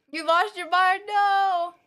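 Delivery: loud and excited disbelief. Real, not acted. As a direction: shout it out in disbelief. Reacts to an hour-long boss fight.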